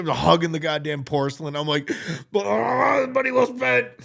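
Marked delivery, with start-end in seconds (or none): 2.3-3.9 put-on high, groaning drunk misery; wailing misery. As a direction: moan it high through mock retching. Acting out drunken vomiting misery.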